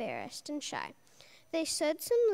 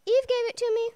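blurted out high and loud; 8 semitones higher, 10 dB louder, faster than usual. Acted. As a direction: blurt it out to shift the blame. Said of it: "Adam blurted out".

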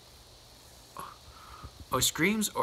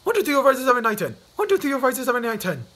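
high and loud, rushing the numbers; loud counting; 8 semitones higher, 7 dB louder, faster than usual. Acted. Mister Jelly counting to ten out loud, high and loud. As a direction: count aloud fighting the fear.

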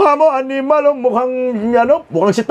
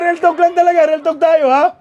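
normal talking voice

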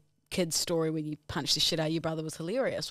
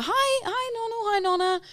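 mock cheery greeting. Mum greeting nonna, imitated.

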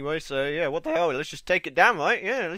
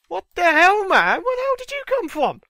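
loud and much higher, caught off guard; startled shout; 11 semitones higher, 5 dB louder than usual. Real, not acted. Player shocked by a sudden mob, shouting high and loud. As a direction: yell as if something just jumped you. A mob surprises him in-game.

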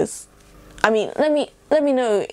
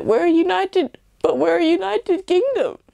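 higher, protesting mock voice; mock defensive English; 10 semitones higher than usual. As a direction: play the Brit insisting on unity. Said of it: "Don't be like".

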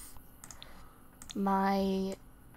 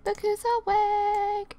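high, slow sing-song greeting; sing-song wake-up; 9 semitones higher, 4 dB louder, slower than usual. Pretend. To the sim who just woke, a high sing-song coo. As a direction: coo it like waking a child.

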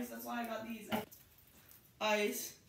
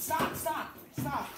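loud repeated stop; 6 semitones higher, 4 dB louder, much slower than usual. Real, not acted. Ice banging together.

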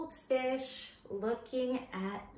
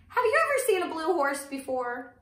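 mock amazement; 6 semitones higher, 6 dB louder, faster than usual. Teacher amazed to children.

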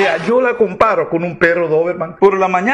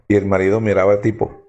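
ordinary talk, nothing marked

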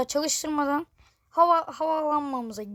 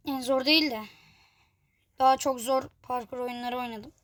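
ordinary delivery

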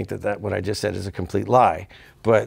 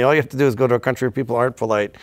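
ordinary talk, nothing marked

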